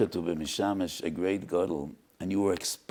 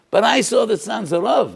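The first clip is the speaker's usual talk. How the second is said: anguished cry; 14 semitones higher, 11 dB louder than usual. Retold or imitated. Father's quoted cry in a story.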